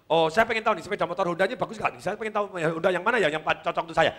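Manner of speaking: rapid, eager string of questions; fast-talking customer. Acted. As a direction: rattle it off like a hurried customer. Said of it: "orang yang ngomongnya cepet". (pelanggan yang ngomongnya cepat)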